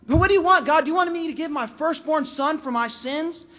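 high, loud, indignant questioning; acted sarcastic outburst at God; exaggerated complaint. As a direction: push the protest to absurd lengths. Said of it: "And the exaggeration begins".

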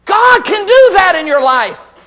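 high, loud, slow proclamation; triumphant preaching shout. Real, not acted. Preacher promising the congregation God can reconcile them, emphatic shout. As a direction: stretch it out as a big promise.

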